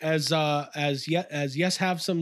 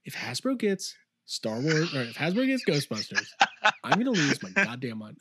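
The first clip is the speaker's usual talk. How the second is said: own impression; 3 semitones lower than usual. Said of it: "just me doing my own impression".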